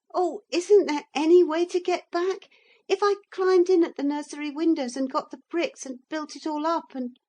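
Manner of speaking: put-on high, loud and fast child; high breathless boyish plea; eager boy. A narrator's reading. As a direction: tumble out a plan in a rush. Boy Philip's voice.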